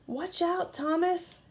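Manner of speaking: high warning cry; cried out. A narrator's reading. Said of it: "cried Diesel".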